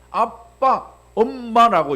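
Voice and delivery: put-on high, loud baby call of "아빠", "엄마". Pastor imitating a toddler calling mom and dad. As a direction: call out like a little child. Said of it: "이야기합니다".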